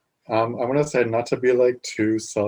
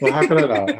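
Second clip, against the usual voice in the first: laughing; 3 semitones higher, 7 dB louder, much faster than usual. Real, not acted. Laughing reply to thanks.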